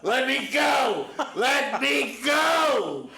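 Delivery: high, slow, full-throated shout; furious yell. Retold or imitated. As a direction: scream to be let go. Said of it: "Tommy turns to me and yells".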